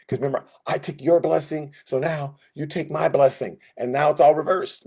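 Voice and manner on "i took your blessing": ordinary talk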